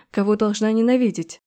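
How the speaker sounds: plain reading voice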